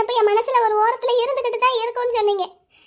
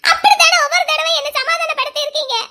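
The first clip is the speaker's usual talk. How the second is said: high, loud bitter laughing yell; 7 semitones higher, 3 dB louder than usual. Acted. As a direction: hurl the accusation with a bitter laugh. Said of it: "கத்தாதடா". (கசப்பான சிரிப்புடன் கத்துதல்)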